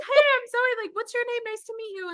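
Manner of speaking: high, bright, friendly hello; high chirpy greeting. Retold or imitated. Greeting a fan who recognized her, high and chirpy.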